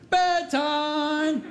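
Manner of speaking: high, drawn-out sing-song call; playful bedtime call. Retold or imitated. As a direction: sing it out to surprise a little child. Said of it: "I snuck up behind her and I said".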